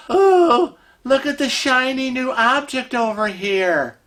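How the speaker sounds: high, wide-eyed mock wonder; distracted excitement. Wife mimicking husband distracted by something new, mock wonder. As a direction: coo like someone lured by a shiny thing. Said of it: "gets involved in something else".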